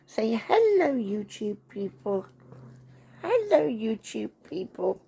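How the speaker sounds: high-pitched playful hello; greeting for the dog. Pretend. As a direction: speak for the pet, starting high. Prompting dog to greet viewers.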